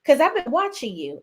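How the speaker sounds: mimicking a wife confronting; [name] quoting. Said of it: "[name] is like".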